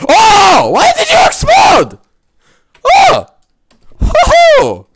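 very high loud shriek; wild high yelling; shrieking outburst. Real, not acted. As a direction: squeal at the explosion. Character explodes again.

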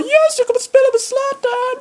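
high sung joyful yell; ecstatic cheer. Real, not acted. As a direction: sing it out with joy. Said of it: "Jag bara".

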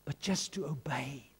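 sudden hushed whisper; whispered exhortation. Real, not acted. Preacher confides the key to the congregation, whispered. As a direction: drop right down to a voiceless whisper.

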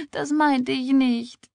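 high, slow tearful protest; weinerlich. Acted. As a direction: protest miserably through tears. Weinende widerspricht der Trösterin. (weinerlich, gedehnt)

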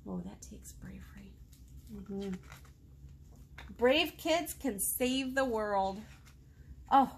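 usual speaking voice

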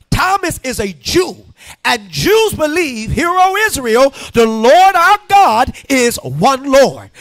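loud, emphatic pulpit proclamation; full-force preaching. Acted. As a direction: drive every phrase home to the congregation.

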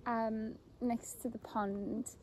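ordinary talk, nothing marked

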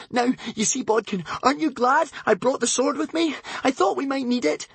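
high Wish voice, trying not to panic; a light hint of breathless girl; panting. A narrator's reading. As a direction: keep it brave over rising panic. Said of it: "panted Wish, trying not to panic".

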